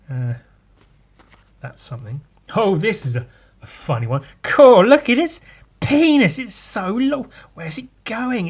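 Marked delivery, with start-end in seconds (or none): 4.4-8.5 much higher and louder, giddy glee; giddy amusement. Real, not acted. Showing a rude sketch to viewers, giddy and squealing. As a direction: squeal over the silly drawing. Gleeful comic commentary.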